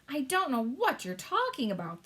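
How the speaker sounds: high irritated king voice; testy king. Acted. King getting testy with the Queen, irritated. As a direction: let impatience creep in. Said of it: "growing testy".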